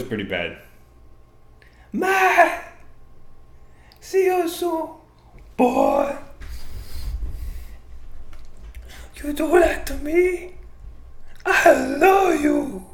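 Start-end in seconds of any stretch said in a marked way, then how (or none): 1.9-12.8 high, loud mock Romero accent. Host repeating his bad take on the fighter, mock Romero accent. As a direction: stretch it out in a hammed-up, high-pitched accent. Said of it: "I can't do the Romero, uh, accent really good".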